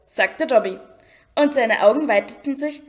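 ordinary delivery

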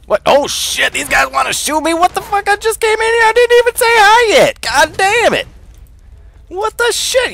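high, loud panicked shouts; panicked yelling. Acted. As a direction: yelp in outraged alarm at being shot at. Suddenly shot at by guards.

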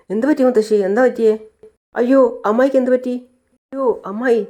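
put-on a girl rushing in, calling out in worry; young niece. Megha voiced in the story.

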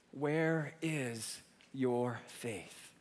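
gentle question. Acted. Jesus questioning his disciples.

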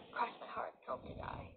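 unvoiced whisper of the rhyme; whispered promise. Real, not acted. Promising viewers Mike lives, whispered oath. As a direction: breathe the oath like a secret.

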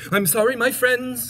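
lightly voiced sorrowful apology; sad elephant. Elephant apologises to his friends, mildly sad high voice. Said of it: "said the elephant sadly".

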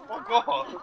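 high laughing dismissal; laughing fake rudeness; laughing brush-off. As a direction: toss it off through a laugh. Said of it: "And I'm like".

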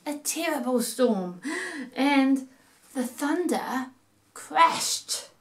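put-on lively sing-song reading to children; storytelling voice. A narrator's reading. Narrator reading a rhyme to kids at home, playful storytelling voice. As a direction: read it like a bedtime rhyme for little ones.

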